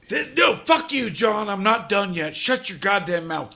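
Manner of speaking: don't let him cut in. Real, not acted. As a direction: snap at him to stop. Angry at interruption.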